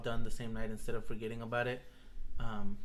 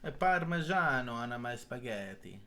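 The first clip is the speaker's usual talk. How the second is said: mock Italian. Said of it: "That's racist".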